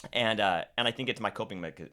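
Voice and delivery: ordinary talk, nothing marked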